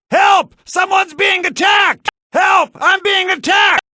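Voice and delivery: high loud frightened shouts; panicked cry for help. Acted. Attacked citizen shouts for help in panic. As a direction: scream for the guards.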